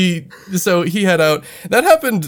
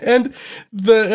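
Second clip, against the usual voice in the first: ordinary delivery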